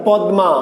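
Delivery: "পদ্মা" as pad-ma; written form. Shown as a demonstration. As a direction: keep m audible after d in "পদ্মা". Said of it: "আমি তো লিখেছি".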